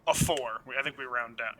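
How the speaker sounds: normal talking voice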